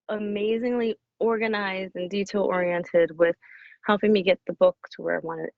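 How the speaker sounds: ordinary talk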